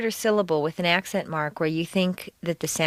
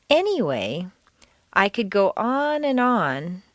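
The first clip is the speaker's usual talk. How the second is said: deliberate reading with big pitch swings on stressed words; exaggerated stress. Shown as a demonstration. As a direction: stretch and swoop each stressed word, pausing between phrases. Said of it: "exaggerate the words far more than you'd normally hear".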